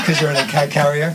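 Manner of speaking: ordinary talk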